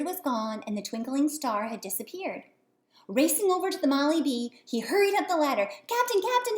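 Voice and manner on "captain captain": high, loud call at the cabin door. Acted. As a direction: call eagerly through the door. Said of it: "he called".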